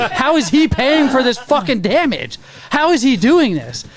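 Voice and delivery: stunned, asking how on earth it worked; amazed disbelief. Retold or imitated. Receptionist amazed the other driver is paying, incredulous. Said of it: "And she's looking at me like".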